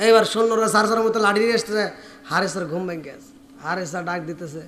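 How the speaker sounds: ordinary talk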